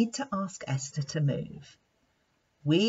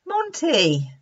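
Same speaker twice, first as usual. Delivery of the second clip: gently calling Monty's name; lion's voice calling; 8 semitones higher, 7 dB louder than usual. Acted. Wise lion Simba calls the monkey, gently firm.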